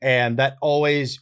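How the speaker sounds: usual speaking voice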